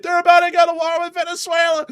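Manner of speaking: very high, loud frantic cry; frantic announcement. Acted. Messenger arriving in Havana with war news, very high and loud. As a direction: blurt it like a breathless messenger.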